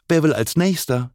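high teacherly call. A narrator's reading. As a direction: call over to waiting pupils, lightly. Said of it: "rief Frau Klein den dreien zu".

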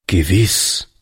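usual speaking voice

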